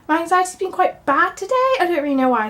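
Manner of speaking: a bit wheezy and short of air. Real, not acted. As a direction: speak through a slightly wheezy chest. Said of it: "Sorry if I sound a bit wheezy".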